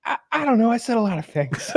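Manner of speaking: high, sheepish ape; the ape shrugging it off. Retold or imitated.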